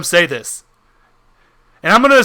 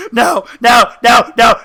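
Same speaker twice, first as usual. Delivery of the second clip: loud, higher panicked cries of refusal; crying and screaming; 4 semitones higher, 5 dB louder than usual. Acted. Creature trapped as his head shakes, acted screaming. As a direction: shout it in hammed-up anguish. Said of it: "he's just crying and screaming".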